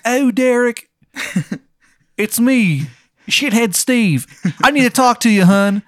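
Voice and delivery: high, loud, hammed-up Maryland accent; cartoonish Maryland caller, high and pushy; bad Maryland accent. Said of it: "this really shitty Maryland accent".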